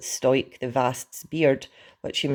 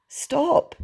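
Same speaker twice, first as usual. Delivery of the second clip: high-pitched urgent cry; yell; 13 semitones higher, 4 dB louder than usual. A narrator's reading. Hiccup halts the mattress attack, high yell. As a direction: yell it high to halt someone. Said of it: "yelled Hiccup".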